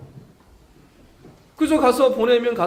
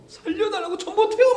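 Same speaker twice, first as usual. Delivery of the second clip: much higher, frantic cry with a laugh; panicked outcry; 13 semitones higher than usual. Shown as a demonstration. Preacher showing the true panicked answer to God, frantic outcry. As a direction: cry "살려달라고" high and frantic like begging for rescue. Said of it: "막 이렇게 막 난리가 난 거예요".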